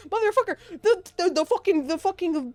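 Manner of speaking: high, sputtering outrage; exasperated rant. Real, not acted. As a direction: stumble over the words in heated disbelief.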